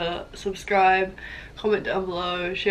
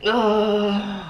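pained gasp; 2 semitones higher, 8 dB louder than usual. Real, not acted. Gasping after hot spice.

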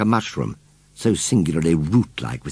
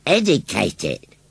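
slow, higher, firm reply; 4 semitones higher, slower than usual. A narrator's reading. Scarecrow tells John his plan, firmly. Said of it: "replied Gummidge firmly".

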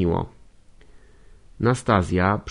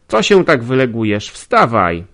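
shouting; 8 dB louder than usual. Acted. Nastazja shouting at the lounging lodger to get up. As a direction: yell at him to get up. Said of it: "krzyknęła".

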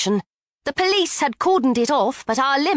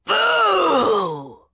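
sharp yell, higher; yelled boo; 6 semitones higher than usual. A narrator's reading. Dwarf yelling at the curb, sharp shout. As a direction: shout to scare the car into being.

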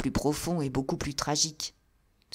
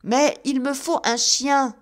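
higher, louder, slow call up; 8 semitones higher, 7 dB louder than usual. A narrator's reading. Narrator calling up to the old man for a dog, loud call. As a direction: call up to the window insistently. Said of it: "criai-je".

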